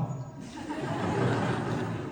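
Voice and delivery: quiet breathy chuckle; a low airy laugh; soft laugh. Real, not acted. Monk laughs as he reaches the punchline.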